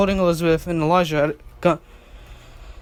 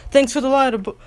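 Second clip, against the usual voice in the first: higher, louder cheeky goodbye; playful cheeky thanks; 6 semitones higher, 3 dB louder, faster than usual. Acted. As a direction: toss it off with a wink. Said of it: "she said, winking".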